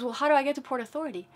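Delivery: a mother racing against time, exasperated; hurried frustrated question. Retold or imitated. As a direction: rush the question out.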